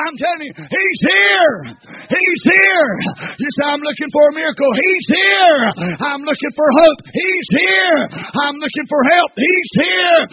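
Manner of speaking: loud, high shouted preaching; preaching at full force. Acted. Preacher shouting Jesus is here to the seekers, full force. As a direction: hammer every "He's here" home.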